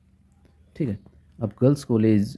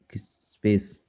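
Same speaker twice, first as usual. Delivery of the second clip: whisper; 4 dB quieter, slower than usual. Acted. Teacher whispers example phrase.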